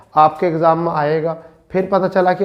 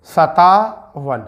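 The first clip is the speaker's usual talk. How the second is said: each syllable slowly drawn out; slow dictation; much slower than usual. Shown as a demonstration. Teacher dictating numbers to students, slow and drawn out. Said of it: "Hindi में ऐसा लिखा जाता है".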